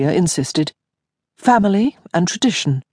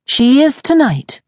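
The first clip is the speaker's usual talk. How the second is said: firm declaration; 9 semitones higher, 5 dB louder than usual. Acted. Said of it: "Honoria declared".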